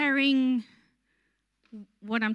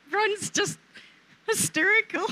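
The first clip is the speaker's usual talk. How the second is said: cracking up, pitch high with laughter; laughing; 10 semitones higher than usual. Real, not acted. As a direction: squeal through helpless laughter. Laughing at Ron.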